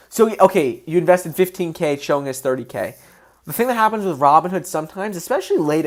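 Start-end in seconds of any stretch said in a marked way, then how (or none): none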